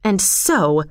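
put-on mildly angry young man; controlled anger in the natural register. A narrator's reading. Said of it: "said John angrily".

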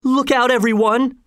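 high-pitched alarmed warning; frightened voice. A narrator's reading. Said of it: "interrupted by a frightened voice".

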